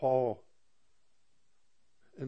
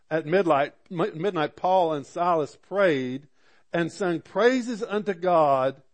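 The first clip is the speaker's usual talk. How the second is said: loud, raised scripture reading; full-force preaching; 4 semitones higher, 7 dB louder than usual. Acted. Pastor reading Acts to his congregation, loud and raised. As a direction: proclaim the story to the whole room.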